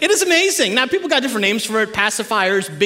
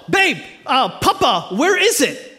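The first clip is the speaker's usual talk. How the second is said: raised, mock-urgent call to his wife; retold yell; slower than usual. Husband calling wife about the missing pacifier, mock yell. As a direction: call out as if to another room. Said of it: "So I yell".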